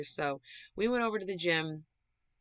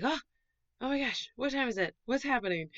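higher, fast mock panic on waking; startled awake; 8 semitones higher, 4 dB louder, faster than usual. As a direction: act out waking with a jolt.

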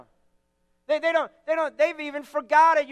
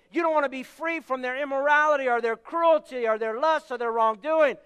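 ordinary talk

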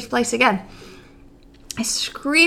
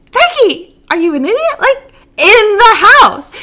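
exasperated; 8 semitones higher, 5 dB louder than usual. Retold or imitated. Exasperated at friend not understanding.

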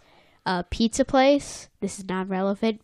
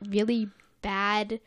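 ordinary talk, nothing marked

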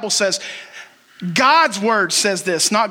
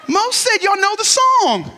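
mock indignant; 11 semitones higher, slower than usual. Real, not acted. Mock protest at youth.

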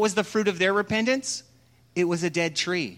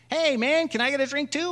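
high, cheeky frog voice; squeaky bar frog asking for a drink; talking frog voice; 8 semitones higher than usual. Acted. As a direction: pitch up like a little talking frog.

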